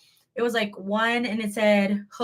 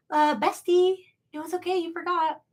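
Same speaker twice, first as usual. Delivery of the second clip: comforting bestie voice; 6 semitones higher than usual. Pretend. Consoling herself in high voice.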